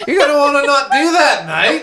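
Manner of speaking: high, loud Aussie bloke warning; laughing mock Australian accent. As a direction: lay on the Aussie "mate". Joins the Aussie impression.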